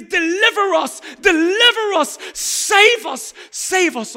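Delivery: shouted pleading cry of the crowd; loud desperate crowd shout. Retold or imitated. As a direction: cry out to be saved at full voice. Crowd cry voiced in sermon.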